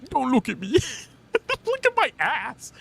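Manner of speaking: high, through giggles; laughing squeal. Real, not acted. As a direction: squeal it while cracking up. Laughing, flustered joke.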